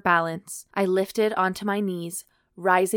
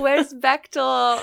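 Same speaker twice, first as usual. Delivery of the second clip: playful longing; 6 semitones higher, 5 dB louder, slower than usual. Pretend. Calling for beloved character.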